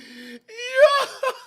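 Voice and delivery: real high-pitched burst of laughter; loud high laugh. Real, not acted. Host cracking up at the caller's bedroom joke, loud high laughter. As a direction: crack up loudly, voice shooting up.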